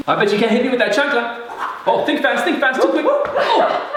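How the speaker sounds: high, fast teasing taunt; playful taunt. Real, not acted. Teasing wife about chancla.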